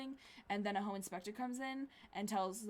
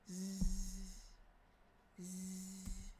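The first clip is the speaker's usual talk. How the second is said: mock snore; 5 dB quieter than usual. Snoring in bed.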